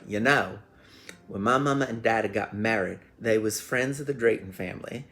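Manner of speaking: hammed-up Southern storyteller; crazy Southern accent. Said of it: "speaking with this crazy little Southern accent".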